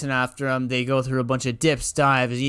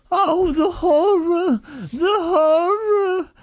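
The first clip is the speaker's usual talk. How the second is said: much higher, louder, slow and crying; Lenny wailing; 5 dB louder, slower than usual. Retold or imitated. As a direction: wail it in a high mock-tragic cry. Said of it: "starts going".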